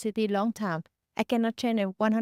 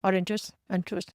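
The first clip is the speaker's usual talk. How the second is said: fast native speech; faster than usual. Retold or imitated. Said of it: "they speak so fast".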